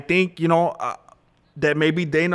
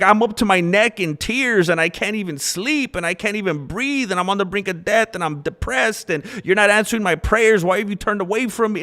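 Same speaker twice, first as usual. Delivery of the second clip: higher, pleading retelling of a despairing psalm; voicing David's despair; 6 semitones higher than usual. David telling God he is in despair, higher and pleading. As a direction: plead as someone drowning in tears. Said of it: "He's like".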